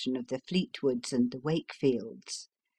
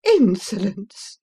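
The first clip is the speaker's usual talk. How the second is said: louder, slow, offended cry; indignant outburst; 4 semitones higher, 7 dB louder, slower than usual. Acted. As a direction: fling the word out in outrage. Ladies' quarrel.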